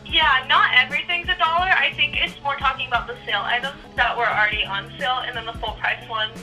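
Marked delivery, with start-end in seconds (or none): none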